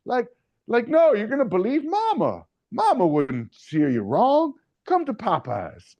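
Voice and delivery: high, sing-song ad pitch voice; hamming up a folksy fried-chicken saleswoman; mock Popeyes ad woman. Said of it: "this woman who reminds you of, like, those racist Popeyes commercials".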